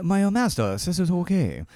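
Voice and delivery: playing a student in mock Japanese accent. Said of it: "the Japanese accent".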